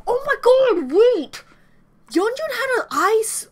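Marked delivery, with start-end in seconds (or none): 0.1-1.4 sudden high loud gasp of shock; shocked shout. Real, not acted. Fan yelling at the screen in shock, a loud high outburst. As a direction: cry out as the realization hits.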